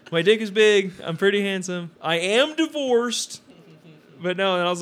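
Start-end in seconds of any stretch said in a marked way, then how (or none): 0.1-3.4 louder, higher, drawn-out joke boast; hammed-up announcing of himself; mock declaration. Joker introducing himself to the stranger, hammed-up declaring.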